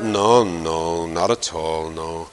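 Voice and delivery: low, sullen muttered no; a pouting prophet refusing, low and fading; sulky low Jonah. Acted. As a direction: grumble it low and fading, sulking.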